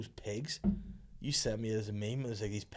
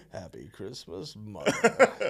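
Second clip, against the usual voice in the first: pig meme voice; 4 dB louder, much slower than usual. Retold or imitated. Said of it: "talk like that".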